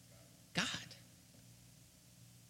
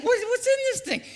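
much higher, laughing in amazement; amazed laughing; 8 dB louder, faster than usual. Retold or imitated. Preacher acting out a donut eater, laughing amazement. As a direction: squeal with delight at the surprise. Imitating surprise at donut filling.

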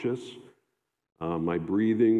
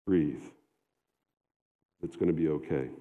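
gentle self-reassurance; 4 semitones lower than usual. Retold or imitated. To himself in bed, a gentle reassuring murmur. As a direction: talk yourself down gently. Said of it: "Reassured myself to the end".

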